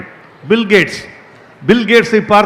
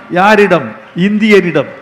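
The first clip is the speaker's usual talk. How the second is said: usual speaking voice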